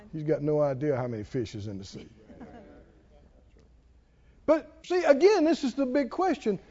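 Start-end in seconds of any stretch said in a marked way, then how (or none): none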